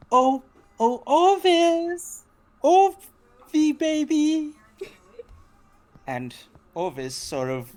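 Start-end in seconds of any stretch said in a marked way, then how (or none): 0.1-4.5 much higher, bright calling voice; high cheery voice. Acted. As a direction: call out sweetly like a doting wife. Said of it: "high and cheery voice interrupts".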